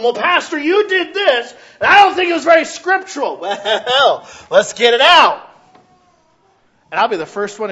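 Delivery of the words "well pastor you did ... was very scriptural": loud, high accusing voice; challenging congregant. Acted. Church member challenging pastor's scripture, loud and high. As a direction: call the pastor out.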